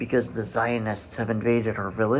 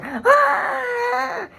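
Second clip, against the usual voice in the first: very high sharp infant shriek; imitated baby scream. Describing the soldier's stomping, a piercing baby shriek. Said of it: "the first time you just hear".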